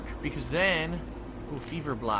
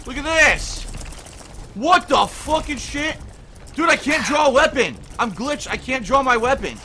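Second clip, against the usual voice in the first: loud, high swearing yell; angry shout; 7 semitones higher, 6 dB louder than usual. Real, not acted. Gamer swearing at the glitched game, angry shout. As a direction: bark it at the broken game. Glitched, can't draw weapon.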